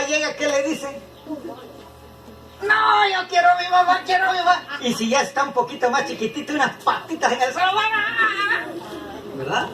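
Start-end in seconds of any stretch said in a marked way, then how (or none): 2.6-4.6 put-on higher, shouted child's wail for mommy; imitating a small child crying at his father, higher and loud. 7.7-8.7 put-on toddler calling.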